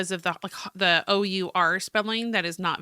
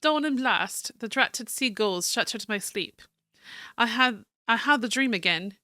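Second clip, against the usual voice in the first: American attempting a refined British accent; fancy British accent; 4 semitones higher than usual. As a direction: read the journal in fancy British English. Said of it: "Fancy British".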